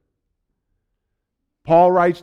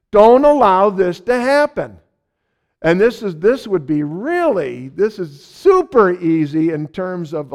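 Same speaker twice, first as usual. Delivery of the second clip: raised, pressing preacher's voice; preaching at full force; 5 semitones higher, 4 dB louder than usual. Acted. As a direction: preach it hard and urgent.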